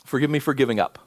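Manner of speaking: heartfelt pastoral prayer. Acted. Leads a closing prayer.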